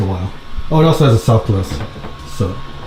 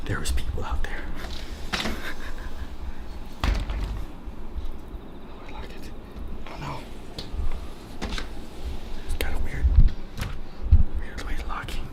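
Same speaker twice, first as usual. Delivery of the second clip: hushed whisper, barely voiced; 6 dB quieter than usual. Real, not acted. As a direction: whisper so nobody wakes. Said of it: "Try to be quiet".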